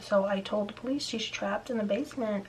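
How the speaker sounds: ordinary talk, nothing marked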